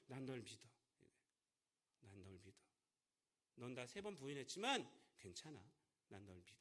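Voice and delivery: soft, gentle promise of trust; tender reassurance. Retold or imitated. Preacher voicing Jesus reassuring Peter, softly and tenderly. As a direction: hush it down to a tender whisper of faith. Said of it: "하나님은 이러신 분입니다".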